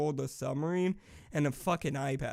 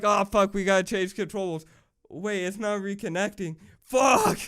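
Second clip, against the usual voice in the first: imagined crew yelling in a panic, louder; hammed-up freakout over a dead controller; mock panic; 4 semitones higher, 6 dB louder than usual. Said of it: "that's probably what they're like".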